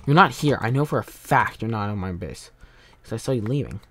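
ordinary talk

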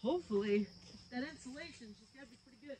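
faint voice behind a wall; muffled through a wall. Real, not acted. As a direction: heard from outside through insulation. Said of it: "hopefully you can hear me through the wall".

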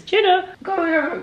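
high sing-song call to the dog; calling the puppy. Real, not acted. Calling her puppy Juno to come here, high call. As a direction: call the puppy over, pitched right up.

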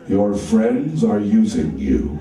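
very low, slow butler voice; slow, deep English butler; Alfred the butler. Acted. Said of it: "he did sound like the butler from Batman".

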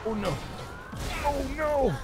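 very high dismayed wail; panicked cry. Real, not acted. Player unable to escape the boss, panicked high cry. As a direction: cry out as disaster closes in.

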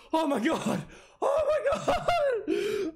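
high cry stretching into a wail; real rising panic; panicked cry. Real, not acted. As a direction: build from shock to a drawn-out wail. Scared by figure in dark room.

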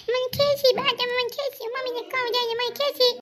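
high whining cry; tearful child complaint. Acted. Child telling on the one who pulled, tearful high whine. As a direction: tattle in a high, crying whine. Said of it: "બંધ કરો બે રડવાનું".

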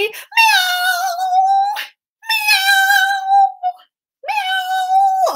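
very high, drawn-out meowing; cat meows. Pretend. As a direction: yowl playfully like a running cat. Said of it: "do some meows again".

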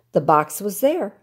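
plain reading voice

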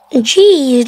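ordinary talk

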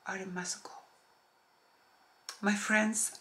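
normal talking voice

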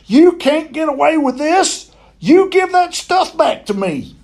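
high-pitched, indignant holler; angry yell. A narrator's reading. Boy demanding his stuff back from the monkey, high angry yell. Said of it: "I yelled".

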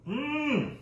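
high, drawn-out mmm of delight; savoring hum. Real, not acted. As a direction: hum like the first sip is heavenly. Tasting his hot chocolate.

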